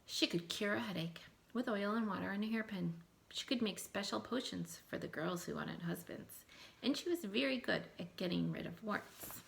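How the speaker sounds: put-on reading a folktale aloud to children, lively; story narration. A narrator's reading. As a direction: tell it like a storybook to a class. Teacher reads folktale aloud.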